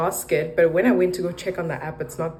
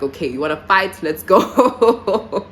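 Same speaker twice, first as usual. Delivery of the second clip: fearless challenge; 3 semitones higher, 6 dB louder than usual. Retold or imitated. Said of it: "to fear nothing and just go out there".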